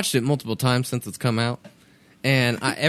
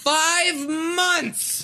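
exasperated exclamation; 13 semitones higher than usual. Real, not acted. Exasperated about movie pacing.

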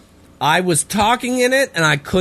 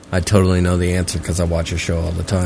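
sarcastic; 10 semitones lower, faster than usual. Pretend. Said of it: "No, you don't".

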